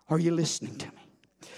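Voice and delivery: usual speaking voice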